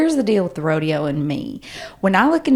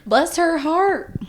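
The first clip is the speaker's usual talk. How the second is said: drawled southern bless her heart; 9 semitones higher, slower than usual. Acted. Mocking the southern phrase back.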